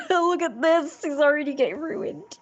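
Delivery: laugh, then whine high in dismay; very high-pitched, half-laughing fretting. Real, not acted. Fretting that the laminated drawing is getting ruined, high whine.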